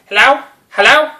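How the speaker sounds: high, loud puzzled hello; caller on phone. Retold or imitated. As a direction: answer the phone as the lost delivery man.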